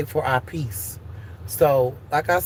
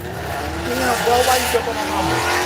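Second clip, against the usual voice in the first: fast mock kid voice, bit higher; hammed-up angry kid pleading; kids defending mama; 5 semitones higher than usual. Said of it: "I'm gonna look like".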